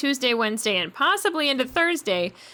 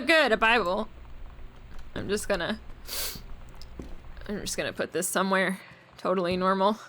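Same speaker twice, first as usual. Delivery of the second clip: ordinary delivery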